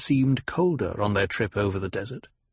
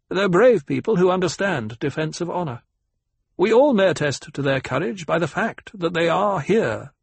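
pitched-up, emphatic formal speech; assured ecologist; 5 semitones higher than usual. Acted. Ecologist vouches for host and hostess, assured and emphatic. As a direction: declare it firmly to the whole table.

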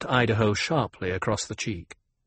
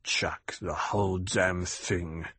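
put-on low, slow, whispery drunken mumble; slurry grumble under the breath; mumbled; 4 semitones lower, 5 dB quieter, slower than usual. A narrator's reading. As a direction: mumble it softly to yourself, barely voiced.

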